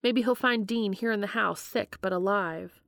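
ordinary delivery

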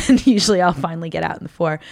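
normal talking voice

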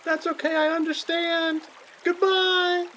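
put-on high, slow child saying goodbye; resigned child. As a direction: give up sweetly like a kid. Drowning child.

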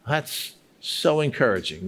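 ordinary talk

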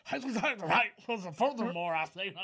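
slurred, drawn-out mock Cajun; Cajun lawyer. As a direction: imitate a rambling Cajun lawyer. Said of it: "the other lawyer who's like".

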